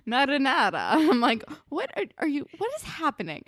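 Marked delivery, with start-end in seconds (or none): 0.1-0.9 mock Canadian-Italian accent, higher and louder; awkward Italian accent. Mocking how Marco awkwardly says it, clumsy Italian accent. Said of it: "he awkwardly says marinara". 1.7-3.4 baffled. Retold or imitated. Baffled reaction to weird accent.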